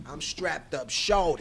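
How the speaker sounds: slow New York swagger; New York accent. Shown as a demonstration. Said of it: "just my New York accent".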